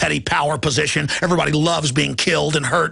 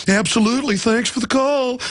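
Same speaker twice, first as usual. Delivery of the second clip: mock cheerful power plant; 5 semitones higher than usual. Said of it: "They'd say".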